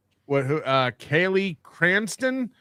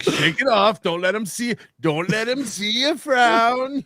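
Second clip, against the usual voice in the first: mock pep talk; 5 semitones higher than usual. Mocking man's pep talk to himself.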